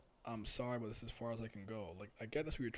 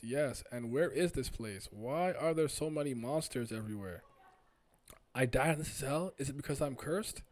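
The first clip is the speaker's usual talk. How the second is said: louder, insistent acted dialogue; agitated characters; 4 semitones higher, 8 dB louder than usual. Stranger insists to doubting questioner it's true, agitated acted dialogue. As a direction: plead and challenge, punching the key words.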